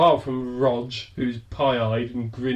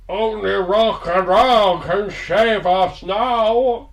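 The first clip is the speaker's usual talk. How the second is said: bad Sean Connery with Norwegian accent; slow, loud, botched Connery in Norwegian accent; 7 semitones higher, 7 dB louder, much slower than usual. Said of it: "in very much the style of a Norwegian Ian Crankin".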